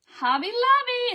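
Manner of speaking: high, loud prize reveal; excited announcement. Real, not acted. Host excitedly revealing the giveaway prize to followers. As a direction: announce it like a game-show prize.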